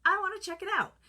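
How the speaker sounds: high, bright, and loud; suddenly eager to try; excited. Retold or imitated. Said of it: "I said".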